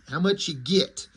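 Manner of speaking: "get" pronounced like "git"; short i in "get", as in "git"; dialect "get". Retold or imitated. Reader repeating the spelled dialogue, "get" said as "git". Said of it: "G I T".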